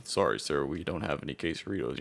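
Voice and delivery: deep, low mock voice of a man; Shaquille O'Neal. Drive-thru man turns away a quesarito order, deep-voiced. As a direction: drop down low and soft for the big man. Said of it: "just a deep-voiced, you know, I don't know, fucking Shaquille O'Neal".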